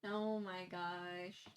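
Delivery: said through a yawn, slow. Real, not acted. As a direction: yawn while you speak. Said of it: "Sorry for my yawn".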